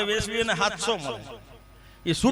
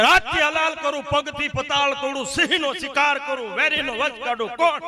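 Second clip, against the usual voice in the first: boastful folk-drama king; 5 semitones higher than usual. Retold or imitated. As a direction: proclaim it like a swaggering stage king. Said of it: "પણ આવીને આમ જ કરે".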